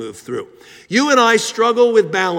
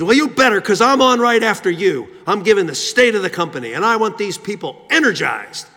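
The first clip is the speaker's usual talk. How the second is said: pushy CEO; 3 semitones higher than usual. Retold or imitated. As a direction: act out an overbearing executive giving orders. Retold CEO pressuring the speaker.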